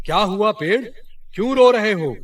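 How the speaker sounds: a concerned deity asks the weeping tree; god calling out to the crying tree; god asking gently. A narrator's reading. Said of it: "और पेड़ से बोले".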